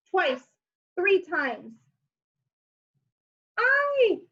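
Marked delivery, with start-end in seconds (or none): 3.6-4.2 high yelp of pain; a sudden high cry as he is butted; troll cry. Acted. Troll crying out, butted off the bridge. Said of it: "cried Troll".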